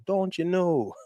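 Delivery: put-on playful Wisconsin accent with "Don't you know?" drawn out in an upper Midwest lilt. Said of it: "I love that, that accent".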